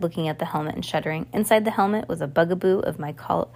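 plain reading voice

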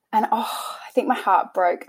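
ordinary talk, nothing marked

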